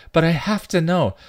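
loud, high-pitched insistence; desperate pleading. A narrator's reading. Dawn answers Spike's warning, pleading. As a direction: plead as if it cannot wait.

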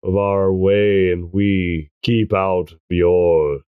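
lightly voiced low, slow warning. Voicing a truce in the story, low slow warning.

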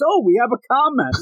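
high, fake-surprised exclamation; mock surprise. Stoned teacher faking surprise at his comment, high. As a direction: act amazed at your own comment. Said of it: "acts surprised".

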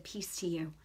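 soft, low near-whisper; hushed blessing. Retold or imitated. Retelling laying a hand on a stranger, hushed.

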